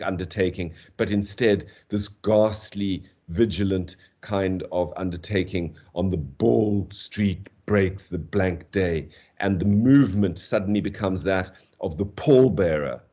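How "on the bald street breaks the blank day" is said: slow recital weighting "bald street breaks"; deliberate beats on "bald", "street", "breaks", "blank", "day"; heavy metrical stresses. Shown as a demonstration. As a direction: recite slowly, each stressed word given its own weight.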